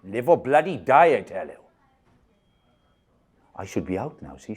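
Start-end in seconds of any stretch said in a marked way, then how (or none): 0.0-1.2 loud shout. Acted. Repeats louder.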